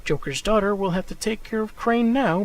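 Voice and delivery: usual speaking voice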